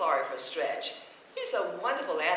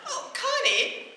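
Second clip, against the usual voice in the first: startled; 13 semitones higher, 7 dB louder than usual. Acted. Bed collapses, calls out alarmed.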